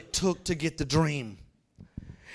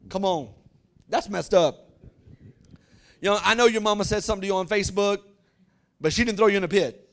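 ordinary talk, nothing marked

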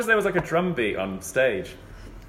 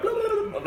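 very high babbling imitation; hammed-up tongues babble; mock speaking in tongues. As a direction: gabble nonsense way up high.

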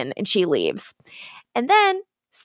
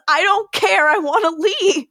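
higher, whiny brush-off; mock petulant Damon; 9 semitones higher than usual. Friends recapping Damon refusing Bonnie, high whiny brush-off. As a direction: toss it off like a bratty kid.

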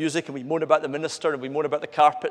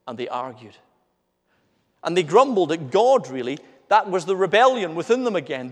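ordinary talk

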